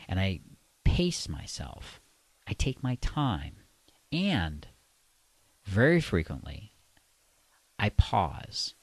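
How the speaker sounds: measured delivery with long, deliberate pauses; slow, sensual pacing. Shown as a demonstration. Coach demonstrating how he paces himself with women, slow and pausing. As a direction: take your time and draw out the tension. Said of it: "You hear what I did there?".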